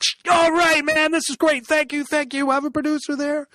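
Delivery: high-pitched, gushing mimicry of grateful thanks; mock excited producer. CNN man thanking him for the ritual tip, mock excited.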